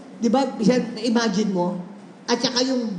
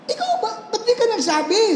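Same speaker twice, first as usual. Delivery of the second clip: high astonished yelp, breaking into a laugh; shocked and amused; surprised; 9 semitones higher than usual. Retold or imitated. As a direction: caught off guard, delighted. Pastor surprised at healed woman.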